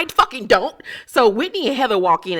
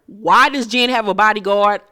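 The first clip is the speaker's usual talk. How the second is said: usual speaking voice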